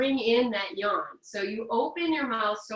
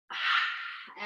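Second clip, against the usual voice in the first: exhaling sigh. Shown as a demonstration.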